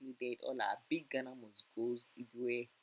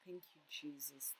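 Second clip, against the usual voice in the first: quiet thanks; 10 dB quieter than usual. Real, not acted. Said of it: "I was so, so emotional".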